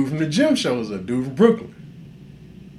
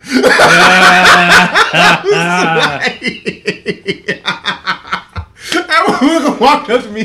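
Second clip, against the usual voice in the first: loud, high laughing through the words; cracking up retelling the morning after, loud; laughing hard; 8 semitones higher, 10 dB louder, slower than usual. Real, not acted. Laughing while telling story.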